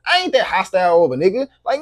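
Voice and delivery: ordinary delivery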